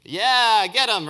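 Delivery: acted rallying cry, high and loud; crowd shout. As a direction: whoop like an approving mob. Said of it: "all the Israelites would shout".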